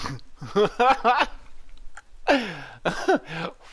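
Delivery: high burst of laughter. Real, not acted. Hunter sneaking up with a dog, high laughing.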